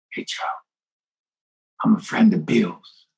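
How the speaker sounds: hushed, furtive whisper of someone approaching in confidence; barely voiced confidential aside; imitated whisper. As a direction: whisper it like a secret password. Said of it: "and they'll whisper".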